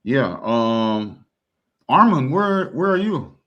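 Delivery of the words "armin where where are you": raised, grand calling out; mock herald. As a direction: hail him like a herald. Mock grand address to Armin.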